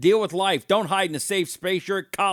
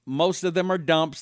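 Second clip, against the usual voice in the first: normal talking voice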